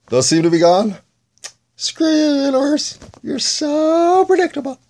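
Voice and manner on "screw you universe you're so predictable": very high, slow squeaky taunt; squeaky mock voice. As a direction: squeak it out like a cartoon. Mock scolding the universe.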